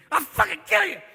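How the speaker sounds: high, loud mock-furious threat; sudden drunken rage. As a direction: explode like a moody drunk. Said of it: "we're moody little fuckers".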